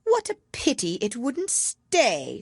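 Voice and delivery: high, slow regretful bird voice; wistful parrot lament; sighing Lory. A narrator's reading. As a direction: sigh with pity. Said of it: "sighed the Lory".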